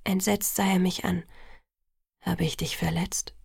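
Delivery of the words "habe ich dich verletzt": soft, lower, half-whispered question; gentle question. A narrator's reading. Edward asking Bella if he hurt her, gentle and soft. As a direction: ask it low and tenderly.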